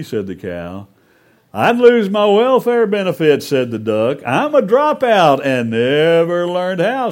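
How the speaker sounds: lively storyteller with loud, high animal quotes; animated story narration. A narrator's reading. Preacher retelling the Little Red Hen fable, animated storytelling. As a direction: tell it as a big, expressive barnyard fable.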